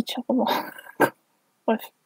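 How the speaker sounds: normal talking voice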